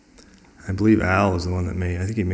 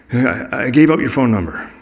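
higher, louder, flustered admission; frantic confession; 4 semitones higher, 5 dB louder than usual. Retold or imitated. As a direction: imitate a friend owning up in a panic. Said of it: "called me frantically".

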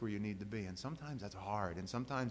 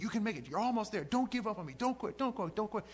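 high, loud urging; urging coach; 12 semitones higher, 4 dB louder than usual. Retold or imitated. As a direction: push him on, don't let him stop.

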